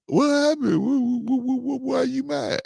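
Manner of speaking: very high, stammering put-on voice; husband imitating his wife acting clueless; mock innocent wife. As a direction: play dumb in a high stutter. Mocking wife acting brand new.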